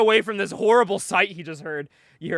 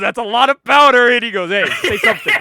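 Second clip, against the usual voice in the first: much louder, heckling bar patron; loud mock taunt; 3 semitones higher, 10 dB louder than usual. Patron mocking the girlfriend, loud imitation. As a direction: call it out loudly as a retold jeer.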